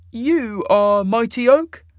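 high, slow, loud question; questioning immortal. A narrator's reading. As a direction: ask it with lofty, high-voiced authority. Isaac's character voice.

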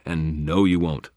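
ordinary delivery, nothing marked

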